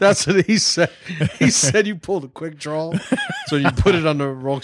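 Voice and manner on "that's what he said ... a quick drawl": laughing. Real, not acted. Laughing over accent joke.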